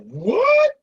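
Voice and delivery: high, loud amazed what, slow at the end; shocked and thrilled disbelief. Retold or imitated. Hearing from sister Red that the party is in the Bronx, high loud amazement. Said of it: "I'm like".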